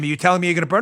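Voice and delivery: ordinary talk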